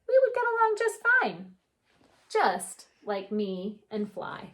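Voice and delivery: gentle storybook read-aloud; unhurried picture book narration; reading to children. A narrator's reading. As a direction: read it like story time for kids.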